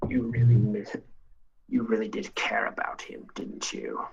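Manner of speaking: put-on sly, whispered old schemer; Palpatine-like whisper. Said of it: "he sounds a little like Emperor Palpatine".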